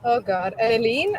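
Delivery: usual speaking voice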